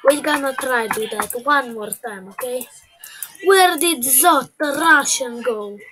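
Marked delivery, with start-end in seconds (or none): 3.4-5.8 "where" called out loud; calling out. Real, not acted. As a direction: yell across the map for him. Calls out for lost character.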